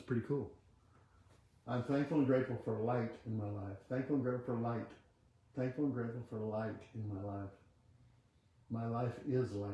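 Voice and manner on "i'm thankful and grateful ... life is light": quiet affirmation. Acted. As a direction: murmur the affirmation like a meditation. Leads a chanted affirmation.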